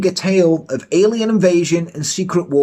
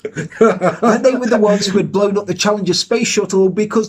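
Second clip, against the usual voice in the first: reading through laughter; giggling through the words; laughing; 6 semitones higher than usual. Real, not acted. Repeated while laughing.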